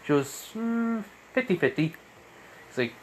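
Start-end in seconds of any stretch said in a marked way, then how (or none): 0.5-1.9 high, light hedging reply; pitched well up, weighing it; pondering hum, then a high verdict; noncommittal answer. Acted. Suit partner answers teasingly.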